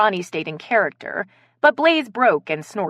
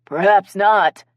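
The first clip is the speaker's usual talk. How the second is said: slow, louder husky character voice; 7 dB louder, slower than usual. A narrator's reading. Improv player casts doubt on the poisoning, husky. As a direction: draw it out huskily. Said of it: "who used a husky voice for her character".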